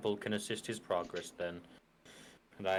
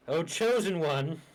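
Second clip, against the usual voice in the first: slow, higher and louder call; sighing summons; 7 semitones higher, 5 dB louder, slower than usual. Acted. Player's character calls the chosen one at the river, sighing summons. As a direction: call out slowly with a theatrical sigh. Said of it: "with a sigh, and I'm like".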